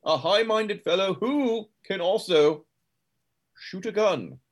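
high, drawn-out musing with swooping pitch; high-minded talk in character. Acted. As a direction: savour it grandly, high and slow. Plays a game character.

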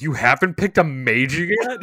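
loud, high, incredulous question; mock disbelief. Retelling his reaction to her undeclared major, loudly incredulous. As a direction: blurt it out in exaggerated shock.

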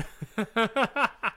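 high cackling villain laugh; maniacal laugh. Pretend. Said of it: "I don't like your maniacal laugh".

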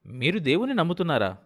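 Telugu: ఆశ్చర్యంగా; surprised. A narrator's reading. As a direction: ask it in open disbelief. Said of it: "Nanakram ఆశ్చర్యంగా".

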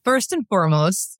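usual speaking voice

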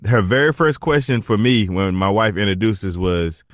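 usual speaking voice

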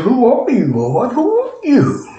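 startled question, rising high at the end; startled Saul. Retold or imitated. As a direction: ask it stunned and bewildered. Saul voiced asking, startled.

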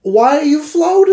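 loud, high demanding questions; frantic questions. Acted. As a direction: fire questions at him in a panic.

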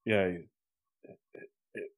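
soft, halting mumble full of pauses; brooding actor impression. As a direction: play a squinting tough guy hesitating over a war memory. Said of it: "He's like".

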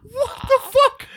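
high, loud, slow incredulous cry; real stunned outburst; stunned disbelief. Real, not acted. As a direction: blurt it out in total shock. Disbelief at the lady's invitation.

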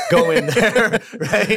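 laughing while picturing mom storming in; amused laughter over a protective mom. Real, not acted. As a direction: laugh the words out, pitched high. Laughing at mom's fierce reaction.

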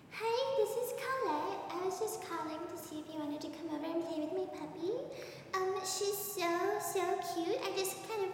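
sing-song like a creepy doll leaving a voicemail; creepy sweet girl voice. Acted. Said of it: "You know who that sounds like".

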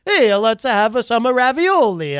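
high, slow hammed-up Italian; mock Italian accent. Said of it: "he's at an Italian restaurant. He's probably like".

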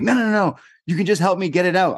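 high, hurried mock pleading; insistent mom. Mother brushing off the neighbour's warning, high and insistent.

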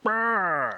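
high-pitched imitated trill. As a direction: make a high, put-on noise of protest.

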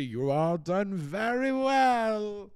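put-on high, loud and slow, a creaky old owner; Mister Grace voice. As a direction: stretch it out like a feeble old gentleman pleased with everyone. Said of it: "he just does the".

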